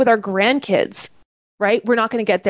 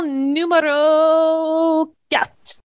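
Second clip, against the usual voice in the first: mock French accent, slow and drawn out; terrible French accent; 7 semitones higher, much slower than usual. Coach naming tool four in hammed-up French accent. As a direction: ham up the French accent on the number. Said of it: "That's my terrible French accent".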